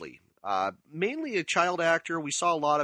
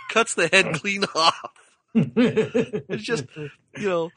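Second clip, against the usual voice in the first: words broken by real laughter, higher; laughing; 6 semitones higher, 6 dB louder, much slower than usual. Real, not acted. Telling the goose scene, laughing helplessly. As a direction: laugh through the line. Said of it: "laughing while telling".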